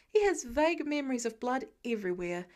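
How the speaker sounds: ordinary delivery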